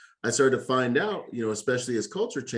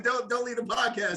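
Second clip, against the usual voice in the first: mock plea; 12 semitones higher than usual.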